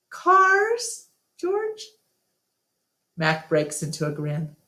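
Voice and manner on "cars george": cars, George? squeaked up very high in disbelief; incredulous. Acted. To Mac about the full parking lot, a high incredulous squeak. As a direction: hit a high, unbelieving squeak.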